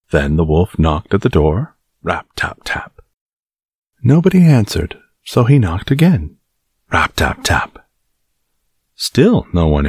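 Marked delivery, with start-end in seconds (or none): 2.0-3.0 soft whispered rap tap; whispered knocking. Acted. Wolf knocking at door. 6.9-7.8 whispered knocking. Acted. Wolf knocks again.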